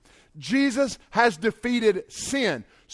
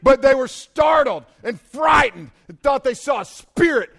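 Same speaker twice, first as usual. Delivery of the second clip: loud, high preaching of the verse; shouted preaching; 7 semitones higher, 9 dB louder than usual. Acted. Preacher reading scripture to the crowd, loud and high. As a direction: proclaim it to the back row.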